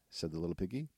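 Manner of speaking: ordinary delivery, nothing marked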